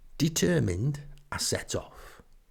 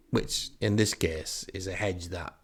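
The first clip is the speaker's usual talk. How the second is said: ordinary talk, nothing marked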